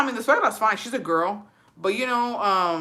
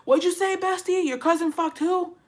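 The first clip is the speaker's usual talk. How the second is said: mock gossiping friend; 10 semitones higher than usual. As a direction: play the friend who can't hear the gossip. Acting out gossip at party.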